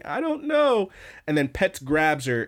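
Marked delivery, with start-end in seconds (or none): 0.0-0.9 very high, mock distressed voice; imitated crying girl. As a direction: answer high up like an upset cartoon girl. Voicing the crying girl's reply.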